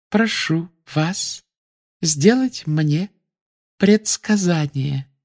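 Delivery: slow, separated, high demand; word by word. A narrator's reading. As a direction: space each word out pointedly. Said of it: "раздельно проговорила Амбридж".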